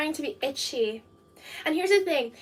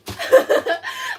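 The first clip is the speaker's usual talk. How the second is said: squealing laugh; laughing at her own fake ad; high burst of laughter; 8 dB louder than usual. Real, not acted. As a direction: crack up after the joke. Laughs after joke ad.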